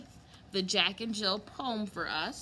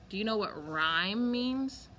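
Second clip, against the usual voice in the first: ordinary delivery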